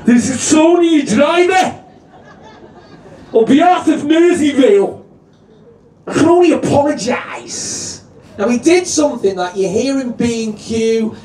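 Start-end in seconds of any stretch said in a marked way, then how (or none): 0.0-8.0 higher, louder, rough tannoy apology; Tony the driver. Retold or imitated. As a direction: blurt it out like a fed-up driver. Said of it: "nothing like Clive the driver".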